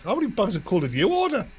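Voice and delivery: a lightly voiced parrot with a sly question; dying parrot asking. Parrot asking the bleeding cat, lightly voiced knowing squawk. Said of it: "looks over and goes".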